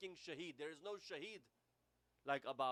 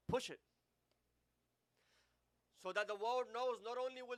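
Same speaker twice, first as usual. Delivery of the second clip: higher, louder, pushing each phrase; fiery preaching; 5 semitones higher, 5 dB louder than usual. Acted. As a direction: preach it to the crowd with force. Rising preaching climax.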